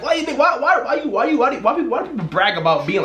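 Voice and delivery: high-pitched, exasperated string of whys; incredulous. Real, not acted. Asking people why they brag about heartbreak, incredulous. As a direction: demand an answer in high disbelief.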